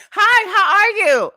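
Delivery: high, loud customer-service greeting; chirpy bartender greeting. Retold or imitated. Bartender greeting a new customer, high and chirpy.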